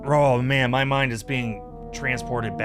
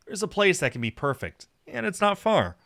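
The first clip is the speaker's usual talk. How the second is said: higher, animated realization; bright idea; 7 semitones higher than usual. Acted. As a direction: land on the answer with a lifted voice. Character suddenly decides on a place.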